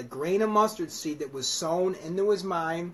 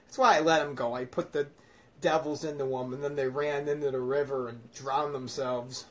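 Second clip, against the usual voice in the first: ordinary talk, nothing marked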